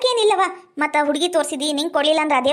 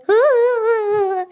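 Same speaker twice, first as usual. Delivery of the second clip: higher, louder hammed-up sobs; mock wailing; 7 semitones higher, 3 dB louder, slower than usual. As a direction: fake mourning wail. Wailing over dead grandmother. (ನಾಟಕೀಯ ಅಳು)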